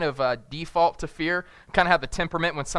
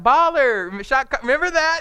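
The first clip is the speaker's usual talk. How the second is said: put-on little girl voice; 10 semitones higher, 8 dB louder than usual. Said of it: "then she's like".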